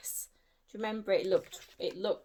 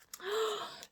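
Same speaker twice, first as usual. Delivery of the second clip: sharp high gasp; 13 semitones higher, 5 dB quieter than usual. Acted. Magic vanishing.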